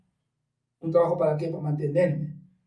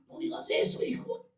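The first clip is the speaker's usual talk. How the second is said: father's voice; 5 dB quieter than usual. Retold or imitated. Father's voice retold.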